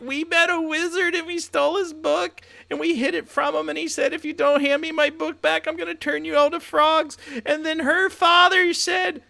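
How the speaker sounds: squeaky high little frog telling its story. Acted. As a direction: talk like a tiny frantic frog, way up high. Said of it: "They're like".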